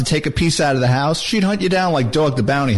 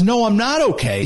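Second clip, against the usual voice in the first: higher-pitched retold exasperated retort; agitated father; 7 semitones higher than usual. Father answering his worried son, agitated. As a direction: imitate a dad at his limit.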